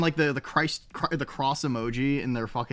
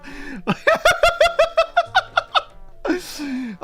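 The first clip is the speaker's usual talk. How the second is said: long high cackling laugh; 6 dB louder than usual. Real, not acted. Laughing at own words.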